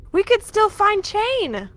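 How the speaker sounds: high-pitched, eager call to the party; excited shout. Acted. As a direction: call it out with sudden hope. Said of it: "She shouts loudly".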